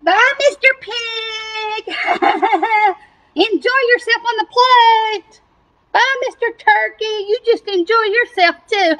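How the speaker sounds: high singsong taunting with laughter; tormenting taunt. Acted. Cow bidding the doomed pig goodbye, high gleeful taunting. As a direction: gloat in a high, drawn-out mocking farewell. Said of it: "she's just tormenting 'em".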